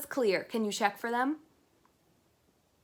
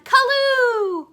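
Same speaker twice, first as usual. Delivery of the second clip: high, loud cheer; happy victory whoop; excited cheer; 14 dB louder than usual. Acted. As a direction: shout it out in triumph. Characters cheer victory dance.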